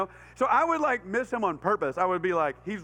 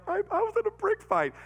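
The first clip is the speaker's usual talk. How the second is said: tearful confession; 12 semitones higher than usual. Retold or imitated. Confessing to mom after the accident.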